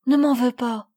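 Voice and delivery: loud, tearful begging; sobbing plea. A narrator's reading. Sophie on her knees to Camille, sobbing plea. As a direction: plead in acted sobs. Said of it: "en sanglotant".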